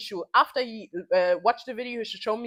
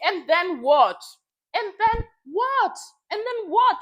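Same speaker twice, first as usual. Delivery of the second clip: exasperated; 8 semitones higher, 5 dB louder, slower than usual. Real, not acted. Repeated mocking challenge.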